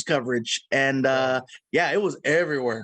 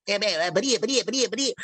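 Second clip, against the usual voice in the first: Porky Pig; 3 semitones higher, faster than usual. Shown as a demonstration. Actor offering his Porky Pig, lightly stuttered. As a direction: stammer through it like Porky Pig. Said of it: "You want Porky Pig?".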